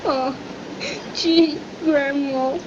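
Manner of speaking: slow, choked-up protest to Grandma; tearful sniveling. Acted. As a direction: sniffle it out slowly, close to tears. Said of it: "Here now, stop sniveling".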